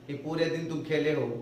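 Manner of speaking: ordinary talk, nothing marked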